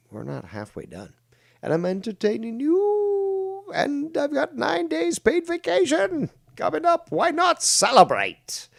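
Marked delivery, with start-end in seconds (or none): 1.6-8.7 very high, drawn-out whooping delivery; hammed-up celebrating. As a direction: squeal it out in over-the-top glee. Hammed-up celebration of streaming and vacation.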